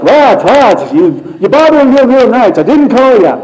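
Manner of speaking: put-on high, loud, grumbling old man's voice; a cranky old priest woken in the night; grumpy old Eli. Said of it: "See, I like that voice".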